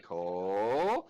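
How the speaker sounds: Ko stretched out slowly as a warning call; drawn-out mock call of Ko. Friend warning Ko about an attacker, calls his name slowly. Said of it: "I'm gonna be like".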